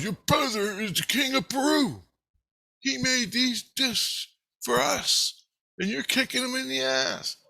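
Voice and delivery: high-pitched scolding without real volume; mock yelling. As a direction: imitate an inner voice telling you off. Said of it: "my subconscious is yelling at me".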